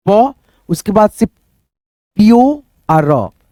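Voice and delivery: drawn-out phonics demo of pure; very slow sounding out. Shown as a demonstration. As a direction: stretch प, then glide into pure very slowly. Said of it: "teacher sounds out slowly".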